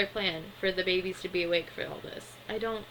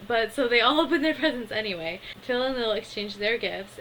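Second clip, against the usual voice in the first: ordinary talk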